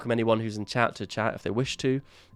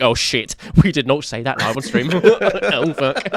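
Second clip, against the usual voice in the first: loud mock panic; 3 semitones higher, 9 dB louder, slower than usual. Imitating panic after editing.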